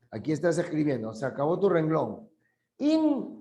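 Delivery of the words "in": IN held high, cut off from the rest of the word; prefix split off. Shown as a demonstration. Teacher splitting a word at the line's end, IN high and separate. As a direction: say IN high, as a piece on its own. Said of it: "al otro lado".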